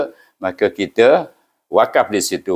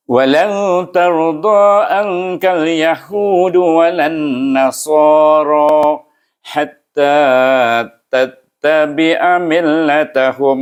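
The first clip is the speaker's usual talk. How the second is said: chanted verse, corrected take; correct recitation; 6 semitones higher than usual. Shown as a demonstration. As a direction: recite it again, now correctly. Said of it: "Okey, baru betul".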